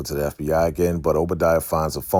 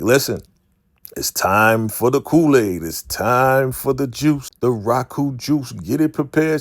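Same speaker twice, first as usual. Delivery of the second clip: raised, high-pitched commanding call; acted proclamation; 8 semitones higher, 5 dB louder than usual. Cult leader ordering the Kool-Aid, loud high proclamation. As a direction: proclaim it louder and higher.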